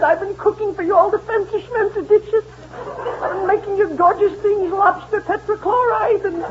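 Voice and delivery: high, weepy whine of a wronged wife; hammed-up tearful pleading. Wife in tears over her cooking, high hammed-up weepy whine. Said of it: "finally she's in tears".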